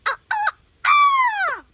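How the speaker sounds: very high, loud, slow chicken squawk. Pretend. Child reading a picture book, squawking for the chicken. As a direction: squawk out each ah like a hen. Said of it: "The chicken say".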